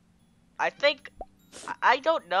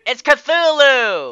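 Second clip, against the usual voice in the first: dramatic reveal; 5 semitones higher, 7 dB louder, much slower than usual. Acted. Big reveal to viewers.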